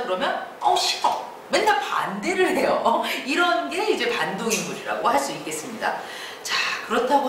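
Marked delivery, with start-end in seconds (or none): 0.6-1.3 curt refusal. Acted. Antagonist flatly refuses.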